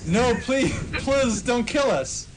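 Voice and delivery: high, loud begging; mock pleading. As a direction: beg for your life, hammed up. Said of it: "pleaded Vampire".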